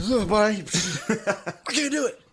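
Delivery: high, laughing mock plea; hammed-up high-pitched brush-off; laughing refusal. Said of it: "I'm like".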